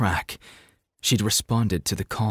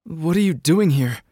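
high, loud, shaken question; shaky and upset; 8 semitones higher, 4 dB louder than usual. A narrator's reading. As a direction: demand it, voice trembling. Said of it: "Mia's voice was shaky".

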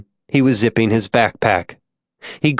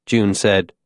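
plain reading voice

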